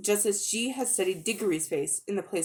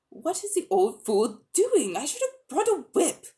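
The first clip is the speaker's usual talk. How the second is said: impatient to herself; 7 semitones higher than usual. A narrator's reading. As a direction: mutter it as if to herself. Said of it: "as if to herself".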